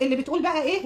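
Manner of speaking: usual speaking voice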